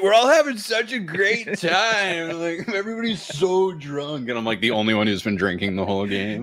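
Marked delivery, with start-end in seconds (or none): 0.0-4.3 high, drawn-out mock euphoria; imitating a very happy drunk; gushing drunk reveller. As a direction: stretch it out high, like a blissed-out drunk fan. Acting out the happy drunk crowd.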